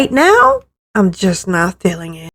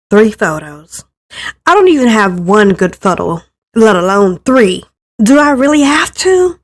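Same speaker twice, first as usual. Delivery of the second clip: ordinary delivery, nothing marked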